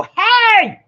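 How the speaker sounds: a sudden, very high, loud "Hey!"; mad yell. Retold or imitated. Imitating her mad yell at Mike, high and loud. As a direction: snap out "Hey!" like she's fed up. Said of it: "he gets to hear me when I go".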